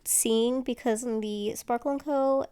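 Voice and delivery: ordinary delivery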